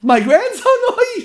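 very high, loud grandmother's cry; panicked wail. Retold or imitated. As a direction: shriek it in panic. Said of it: "she starts screaming".

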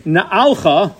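"Na'alcha" set apart as na-AL-kha; Yoshua's wording. Shown as a demonstration. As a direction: isolate "Na'alcha" in three distinct syllables, na-AL-kha. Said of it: "as opposed to this week's parsha".

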